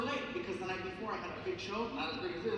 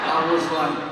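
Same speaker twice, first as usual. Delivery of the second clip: normal talking voice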